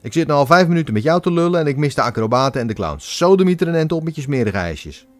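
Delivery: fast, annoyed telling-off; irritated. A narrator's reading. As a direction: snap him away impatiently. Annoyed crowd tells him off. (geïrriteerd publiek stuurt de verkoper weg)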